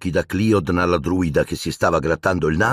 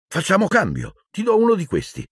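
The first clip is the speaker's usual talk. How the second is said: high-pitched, eager proposal; eager offer; 13 semitones higher than usual. Acted. Boy offering his friend a trade, eager and high-pitched.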